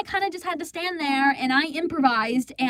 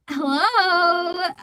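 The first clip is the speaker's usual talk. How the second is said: exasperated hello; 4 semitones higher than usual. Retold or imitated. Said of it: "what made me even more angrier".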